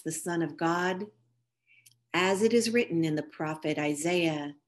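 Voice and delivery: usual speaking voice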